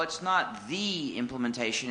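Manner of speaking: THE said as a full "thee", set apart; stress on THE. Real, not acted. Speaker correcting a questioner, stress on THE as thee. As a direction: lean on "the" as thee.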